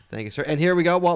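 usual speaking voice

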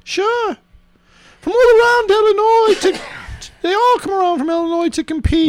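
high British character voice; British, pitched well up; British accent. Acted. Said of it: "so he's British?".

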